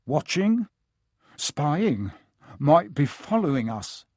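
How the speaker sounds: ordinary delivery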